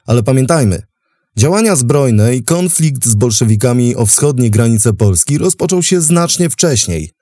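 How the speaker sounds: ordinary delivery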